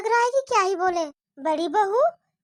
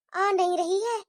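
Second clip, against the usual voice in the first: ordinary talk, nothing marked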